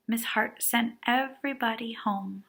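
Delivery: usual speaking voice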